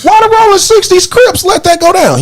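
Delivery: high-pitched amazed question; incredulous. Real, not acted. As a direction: ask it like you can't believe it.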